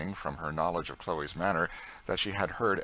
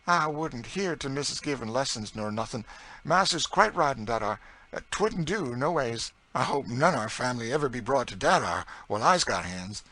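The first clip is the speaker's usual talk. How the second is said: higher, emphatic folksy dialect; protesting Southern cook; 5 semitones higher, 5 dB louder than usual. A narrator's reading. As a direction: object loyally in Southern dialect. Narrator voices Chloe protesting.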